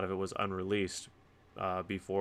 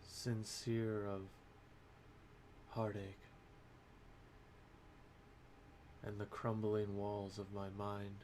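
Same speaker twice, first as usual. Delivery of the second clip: solemn poem recitation; 15 dB quieter than usual. Acted. Poet opening a book review with bleak verse, hushed and solemn. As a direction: recite it quietly with long, heavy pauses.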